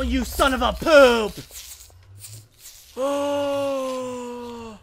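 ordinary talk, nothing marked

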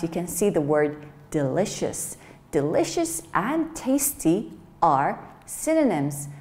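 ordinary delivery

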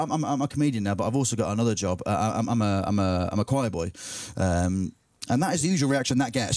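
ordinary talk

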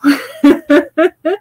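high rising giggle; laugh. Real, not acted. Joking about long goodbyes, rising giggle. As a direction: let a real giggle climb. Laughing at her own joke.

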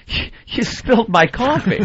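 high, drawn-out retold complaint; mock laughing complaint. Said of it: "Like he's laughing".

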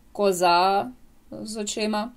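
ordinary talk, nothing marked

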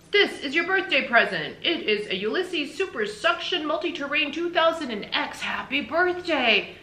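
high, loud gift-giver's enthusiasm; proud husband unveiling a birthday present; Mister Tickum, excited. Acted. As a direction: present the gift like a thrilled showman.

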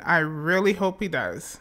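usual speaking voice

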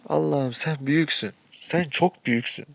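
loud, emphatic cry to the heavens; exasperated appeal to God. Real, not acted. Player appeals to God mid-match, loud and exasperated.

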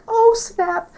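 high-pitched "oh", then dropping; retold surprise. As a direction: react to startling news in a high voice. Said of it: "And I was like".

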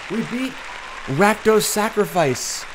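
high, loud, slow triumph; excited. Real, not acted. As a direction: savor the win out loud. Viert de overwinning.

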